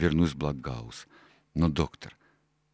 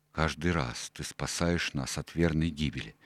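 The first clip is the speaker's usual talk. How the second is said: ordinary delivery, nothing marked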